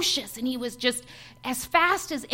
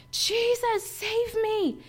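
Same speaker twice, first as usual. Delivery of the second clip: high desperate plea, much higher pitched; crying out; 9 semitones higher, much slower than usual. Retold or imitated. Clobbered by demons, pleading to Jesus, high cry. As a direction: call out to Jesus for rescue, pitch way up. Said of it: "I cried out to God".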